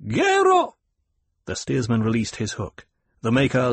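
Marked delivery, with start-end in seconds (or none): 0.1-0.8 high ringing one-word call; shouted command. A narrator's reading. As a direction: call it out to the steersman. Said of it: "Paul shouted".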